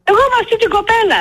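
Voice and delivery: high, quick protest retelling a demand; re-enacted caller's high-pitched insistence; retold insistent plea.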